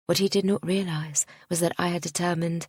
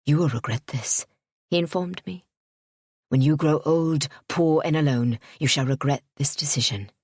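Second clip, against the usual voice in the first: menacing; 3 semitones lower, 4 dB louder than usual. A narrator's reading. Suitor's parting threat.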